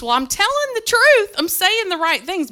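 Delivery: high, loud indignant self-defense; mock angry rant. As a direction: re-enact unleashing on the staff meeting. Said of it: "but I am mad about it".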